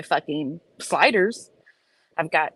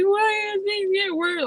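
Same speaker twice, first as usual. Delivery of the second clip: much higher, crying whine; imitated sobbing. Crying to Jared on the phone, imitated sobbing. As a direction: replay the sob on the phone.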